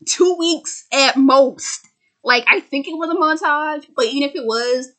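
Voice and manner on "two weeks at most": high, drawn-out disbelief; slowing down on the last words; incredulous mockery. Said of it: "I'm like".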